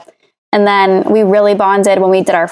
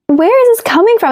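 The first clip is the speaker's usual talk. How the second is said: mock puzzled; 13 semitones higher than usual. Retelling her own puzzled reaction.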